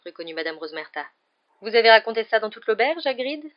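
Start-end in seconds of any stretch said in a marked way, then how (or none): 1.6-3.4 loud, higher, exasperated reproach; exasperated question climbing at the end. A narrator's reading. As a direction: scold him in exasperation, rising sharply on the name. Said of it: "d'un ton exaspéré".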